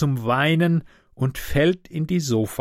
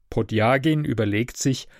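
ordinary delivery

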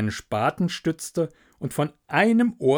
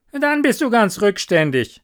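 high, loud mild scorn; scornful water rat; 8 semitones higher, 8 dB louder than usual. Acted. Water rat rebuking the linnet, scornful. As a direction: put the storyteller down, high and loud.

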